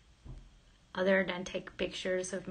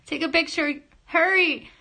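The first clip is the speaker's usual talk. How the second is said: higher and much louder, rushing; urgent excitement; 8 semitones higher, 8 dB louder than usual. Real, not acted. Streamer urges viewers to grab a screenshot, loud and excited. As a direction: hurry them before the moment passes. Urging viewers to snap her face.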